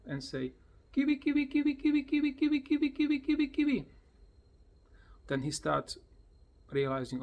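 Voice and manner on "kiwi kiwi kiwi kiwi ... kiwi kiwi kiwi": very high, loud rapid chant; high, loud, bouncing Kiwi kiwi; excited high Kiwi. Shown as a demonstration. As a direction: squeal the name over and over. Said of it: "when you come closer".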